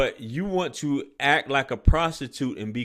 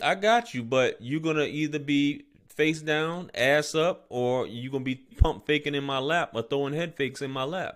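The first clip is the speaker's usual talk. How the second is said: normal talking voice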